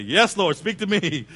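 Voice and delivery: high, loud mock prayer; mock excited prayer. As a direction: cry out to the Lord in playful rapture. Mock answering the call.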